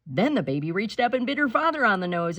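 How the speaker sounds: ordinary delivery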